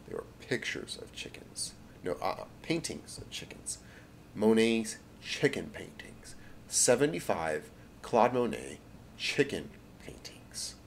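a light, slow, reverent whisper; softly awestruck over the paintings; hushed awe. Acted. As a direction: murmur it as if treasure lies before you. Hitler awed by Monet paintings.

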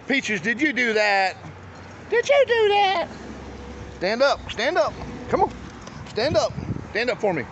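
high coaxing dog talk; pet voice. Real, not acted. Owner coaxing dog Peaches to stand, high pet voice.

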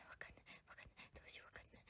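tiny voiceless whisper to oneself; barely audible, flustered whispering; helpless whisper. Real, not acted. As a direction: whisper it, lost and stuck. Stuck on an English word.

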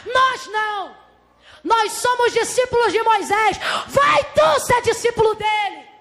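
high, forceful rejection; acted indignant shout; indignant synagogue leaders. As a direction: throw the accusation back with scorn. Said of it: "olha a palavra dos principais da sinagoga".

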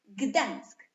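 "Gdańsk" loud, as one single beat; jedna sylaba. Shown as a demonstration. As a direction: punch out "Gdańsk" as one whole syllable. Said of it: "Ile sylab jest w słowie Gdańsk?". („Gdańsk” głośno, jedną sylabą)

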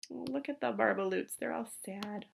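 gentle, hushed aside. Real, not acted. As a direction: quietly point out the sad barbaloots. Aside to children, soft.

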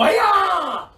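high, loud kiai; karate shout. Shown as a demonstration. Teacher shouting the karate yell in any voice. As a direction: give it everything. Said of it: "ready, set, go".

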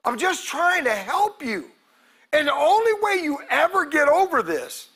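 ordinary talk

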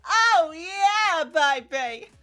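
loud, high victory yell; hype shout. Real, not acted. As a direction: shout with joy at the win. Celebrating the pull.

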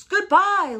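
high, loud farewell yelp; barking fox. A narrator's reading. Fox runs off from the Gruffalo, barking farewell. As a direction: bark a hasty farewell. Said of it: "barked Fox".

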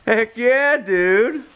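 high, hyped cheer; excited. Real, not acted. Dad cheers at gift.

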